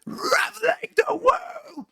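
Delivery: a quick falsetto grunge demo; grungy falsetto. Retold or imitated. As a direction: imitate the breathy, grungy falsetto line. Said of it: "falsetto with that grunginess where you go".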